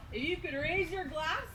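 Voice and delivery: rising, slow toast call. Real, not acted. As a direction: lift the room into a toast. Toast call to raise glasses.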